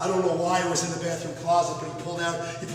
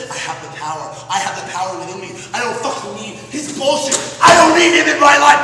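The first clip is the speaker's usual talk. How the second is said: from firm resolve up to a full, much louder yell; building to a furious shout; 2 semitones higher, 12 dB louder than usual. Acted. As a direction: swell from resolve to rage. Boy rises against his uncle.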